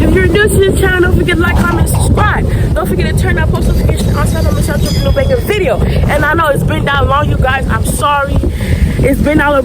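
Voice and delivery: upbeat YouTube intro voice; vlogger intro. Acted. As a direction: hype up the channel greeting. Vlog intro.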